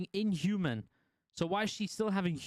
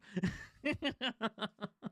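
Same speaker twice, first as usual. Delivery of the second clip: real laugh; 6 semitones higher than usual. Real, not acted. Laughing recalling the trial.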